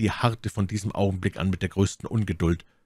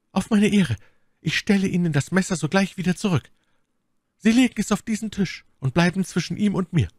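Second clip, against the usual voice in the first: much higher, louder, quick promise; lightly voiced woman swearing on her honour; earnest pledge; 13 semitones higher, 3 dB louder than usual. A narrator's reading. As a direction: pledge on your honour, urgently. My Lady gives her word.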